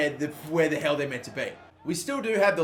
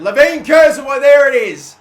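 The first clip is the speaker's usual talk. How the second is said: shouted goal call, much higher; loud shout; 8 semitones higher, 14 dB louder than usual. Real, not acted. Gamer shouting as the opponent scores, loud. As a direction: yell as the goal goes in.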